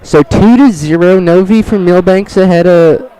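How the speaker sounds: live wrestling call; play-by-play. Acted. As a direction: call the action like an announcer. Announcer calls the match.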